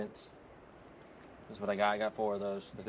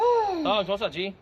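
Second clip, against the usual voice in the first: high, loud call to the dogs; 10 semitones higher, 8 dB louder, much slower than usual. Real, not acted. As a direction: sing out to get their attention. Calling the dogs over.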